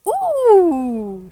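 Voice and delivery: high loud delighted ooh; squeal. Acted. Mouse opening her parcel, delighted squeal. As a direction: squeal over the present. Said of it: "she squealed".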